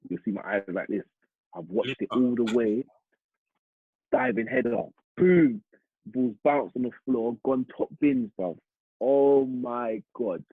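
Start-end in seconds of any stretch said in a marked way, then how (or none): 4.1-5.6 excited goal call. Real, not acted. 9.0-10.4 drawn out slowly, in awe; slow amazed oh my God; slow awed exclamation. Real, not acted.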